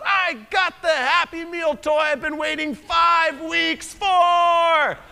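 put-on high, slow, gleeful child's yell; excited shout. Friend Ellie bursting into the sanctuary, gleeful high shout. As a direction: shout it like a kid who finally won. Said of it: "at the top of her lungs saying".

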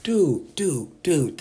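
ordinary talk

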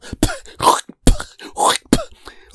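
shrill unvoiced wheeze of a laugh; squeaky sneaky giggle from siblings; high wheezing cackle. Siblings spitting in food, high wheezing cackle.

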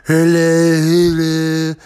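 mock Trump greeting; Trump impression. Shown as a demonstration. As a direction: lean into the Trump hello. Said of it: "all the people who did the Trumps".

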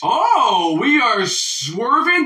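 loud, high, drawn-out call-out; exclaimed interruption. Real, not acted. Host calls out guest for changing the subject, loud exclamation. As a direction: stretch the words out high and loud. Cutting off off-topic swerve.

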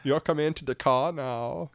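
silly Swedish lilt; mock Swedish accent. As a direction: play along as a hammed-up Swedish driver. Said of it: "more like a Swedish".

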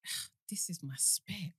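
awed, slow whisper; whispered aside. Retold or imitated. Confiding to friend Abigail at a wedding, whispered. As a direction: breathe it slowly under your breath.

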